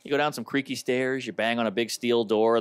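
ordinary delivery

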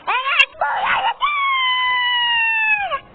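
high, slow crying shout; sobbing frustrated cry; crying high wail. Real, not acted. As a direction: wail it out, crushed by the fall. Falls again in the game.

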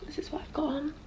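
soft unvoiced whisper. Real, not acted. Telling viewers her outfit, a real whisper. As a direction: breathe it quietly.

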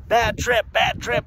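high-pitched chanted warning; mock alarm. God within warning drug users, high mock alarm. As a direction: call it out high, like a silly alert.

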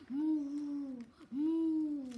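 drawn-out mooing cow. Shown as a demonstration. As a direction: moo like a cow for kids.

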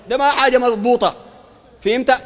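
normal talking voice

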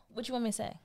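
high, unraised voice; calm provocation. Acted. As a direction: ask it lightly, without heat. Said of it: "I'm just that calm".

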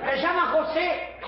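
high-pitched calls to a straying animal; calling out. Acted. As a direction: coax the cow over from afar. Llama a la vaca que se aleja. (llamando con voz aguda)

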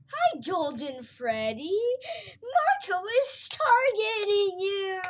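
slow, high, loud singsong greeting. Real, not acted. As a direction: drawl it out loud and playful.

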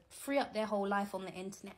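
normal talking voice

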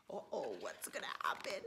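low, fast uh-oh; spooky suspense. Acted. Reading to kids, a low suspenseful uh-oh. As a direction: tease the danger, low and quick.

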